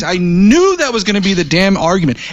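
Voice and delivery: snapping back in frustration; loud sharp voice. Real, not acted. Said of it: "Why are you yelling at me".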